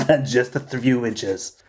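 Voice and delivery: slow, stretched politician parody; Donald Trump impression. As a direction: draw out the words in mock-presidential style. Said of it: "That's my Donald Trump impression".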